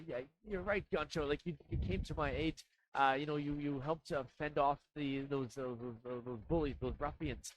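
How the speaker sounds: a hint of a small rushed gnome thanking his helper; gnome, grateful. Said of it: "and he goes".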